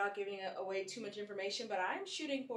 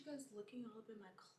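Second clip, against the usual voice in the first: whisper; 16 dB quieter, faster than usual. Real, not acted. Whispered aside to camera.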